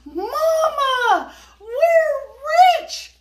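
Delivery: high, loud joyful shout; a boy yelling happily to his mother; excited cry. Acted. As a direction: burst in with the news. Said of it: "he cried".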